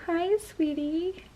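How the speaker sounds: slow, soft and lifted; tender. Real, not acted. Mother greeting the waking toddler.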